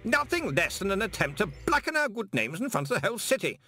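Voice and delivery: high-pitched, righteous complaint; indignant protest. A narrator's reading. Stoley protesting to the room about blackened good names, indignant protest. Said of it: "Stoley was protesting".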